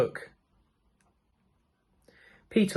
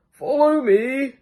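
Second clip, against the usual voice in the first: put-on high, slow old man; 10 semitones higher, 4 dB louder, slower than usual. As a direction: beckon like an old sage. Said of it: "said the old man".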